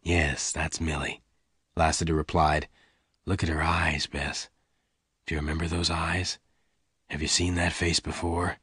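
low, very soft man's voice; gentle Lassiter. A narrator's reading. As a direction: coax her memory, quiet and deep. Voices Lassiter softly questioning Bess.

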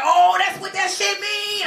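high, drawn-out dawning discovery; mock realization. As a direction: stretch it out high as it sinks in. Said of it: "on the phone with this girl".

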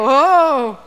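loud, drawn-out exclamation; demonstrated amazement. Shown as a demonstration. Leader prompting the audience to react, loud mock awe. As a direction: cue the crowd with a big mock gasp-word. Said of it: "Everybody go".

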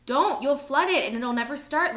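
loud, high protest; urgent warning. A narrator's reading. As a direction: cry out to stop him. Urgent struggle over the engine chain.

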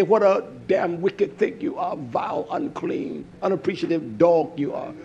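low, hissed self-scolding; berating self. Retold or imitated. Preacher in the garden cursing himself, low and bitter. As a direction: scold yourself like a dog. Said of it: "I'm talking like this".

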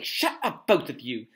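loud, sharp command. A narrator's reading. Harry silences the bickering pair, sharply. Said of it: "said Harry sharply".

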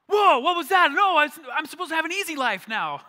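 high, loud mock-panicked yelling; hammed-up indignant outcry; shocked protest. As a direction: yell it like a blindsided new believer. Acts shocked new believer protesting.

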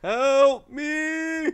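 very high, loud, drawn-out scream for help; piercing cartoonish cry for rescue; screamed plea. Real, not acted. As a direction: wail it out at full volume. Calls for rescue in game.

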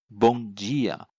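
bom dia drawn out, di as "jee"; Brazilian G sound. Shown as a demonstration. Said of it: "就是发成G的音". (慢读bom dia，di发成“ji”)

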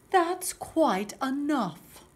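Mama Bear. Acted. Mama Bear ending the fuss, firm slow reproof. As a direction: put a firm stop to the fuss. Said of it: "said Mama Bear".

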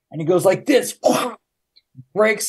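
normal talking voice

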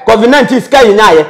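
ordinary delivery

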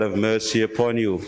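ordinary delivery

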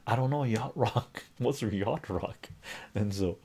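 usual speaking voice

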